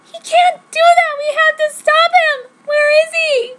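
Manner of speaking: high, loud hammed-up tearful yell. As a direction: shriek it in overacted panic. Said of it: "I yellowed".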